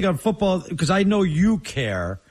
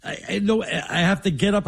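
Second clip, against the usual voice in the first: ordinary delivery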